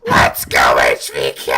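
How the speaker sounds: slow, drawn-out cheering yell; hype yell. Retold or imitated. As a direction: belt it like a fan cheering. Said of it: "like, you yelling".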